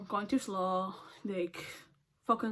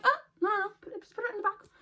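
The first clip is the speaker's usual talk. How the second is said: mock coworker, higher and fast; coworker's high voice; 11 semitones higher, much faster than usual. Coworker hurriedly waving off the dropped chicken, higher mock voice. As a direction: toss off her fussing quickly, pitched up.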